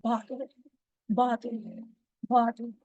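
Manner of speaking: bottle with a clear B; bottle, bottle, bottle, crisp B. Shown as a demonstration. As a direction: say bottle, lips together, then release. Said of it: "when you say".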